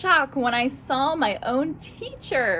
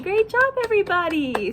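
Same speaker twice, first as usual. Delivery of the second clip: excited praise; 7 semitones higher, 3 dB louder than usual. Real, not acted. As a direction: beam praise at them. Praising children.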